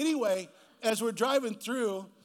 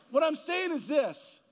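preaching; 4 semitones higher, 4 dB louder than usual. Acted. Sermon climax, slowed.

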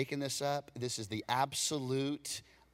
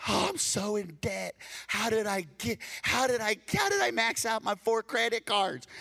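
higher, louder whine then scolding retort; mock whiny debtor; 7 semitones higher, 3 dB louder than usual. Preacher acting out a debtor whining about maxed-out cards.

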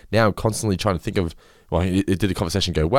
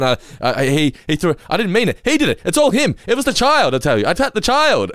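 high, flustered stammer building to protest; re-enacting a panicked denial; 9 semitones higher, 3 dB louder than usual. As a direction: blurt out frantic excuses, pitch climbing. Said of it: "the stuttering over my words".

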